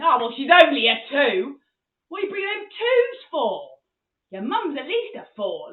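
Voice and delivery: booming mock-dad complaint; hammed-up loud fatherly grumble; loud tactless dad. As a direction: announce it loudly, outraged at the standard. Dad rating girlfriend, hypothetical, acted.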